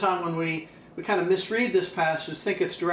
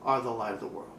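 tender charge; 5 semitones lower than usual. Acted. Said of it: "He looks to them in the eye and he says".

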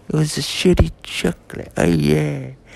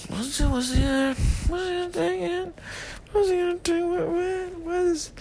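high squeaky voice; 5 dB quieter than usual. Retold or imitated. Squeaky voice while thinking.

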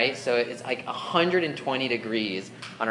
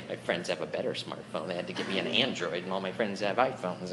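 low, much softer sulky whine; whiny kid; 6 semitones lower, 8 dB quieter than usual. Pretend. Preacher voicing a kid whining for a smartphone, soft and sulky. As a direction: whine it under your breath like a kid.

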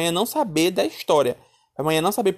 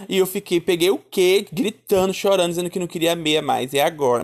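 normal talking voice

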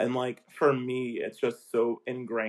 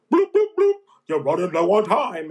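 app alert voice; 8 semitones higher, 10 dB louder than usual. Retold or imitated. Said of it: "the app is gonna be like".

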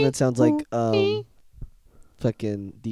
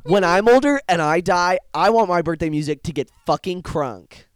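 excited; 7 semitones higher, 7 dB louder than usual. Real, not acted. Hyped about death party.

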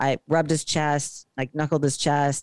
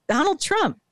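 very high-pitched retold reply; acted-out answer, pitched way up. Wife updating amnesiac husband, retold high-pitched answer.

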